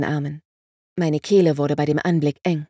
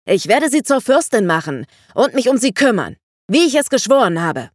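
loud, higher bellowed promise; roaring vow; 5 semitones higher, 5 dB louder than usual. A narrator's reading. Vangelis swearing to make her a princess: loud roar. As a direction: keep roaring the oath at full voice. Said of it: "brüllte Vangelis".

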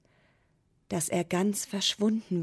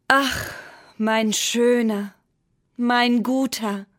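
louder, slow longing call; 4 semitones higher, 6 dB louder, slower than usual. A narrator's reading. Moon calling to the pencil, loud and slow. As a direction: call out slowly to someone far away. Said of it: "rief der Mond".

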